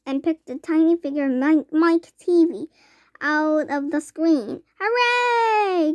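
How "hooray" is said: high, louder hooray; gleeful cheer; delighted cry. A narrator's reading. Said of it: "cried Mister Wonka".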